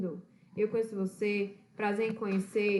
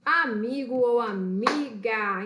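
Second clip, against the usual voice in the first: slow, louder answer reveal; 4 dB louder, slower than usual. Shown as a demonstration. Teacher reveals the meaning of friend, slow louder reveal. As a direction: draw out the answer like a prize.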